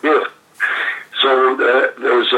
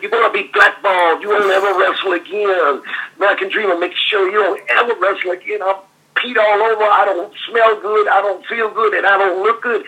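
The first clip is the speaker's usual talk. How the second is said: high, mock-indignant rant; imitating the American Dream; 8 semitones higher than usual. Retelling Dusty's rant at the rookie, high and indignant. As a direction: whine it like an outraged star. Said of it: "He said".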